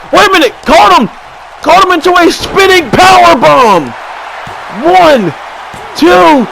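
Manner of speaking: high and loud hype yell; shouted play-by-play burst; excited call. Real, not acted. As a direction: scream the count as it happens. Calling a big power bomb.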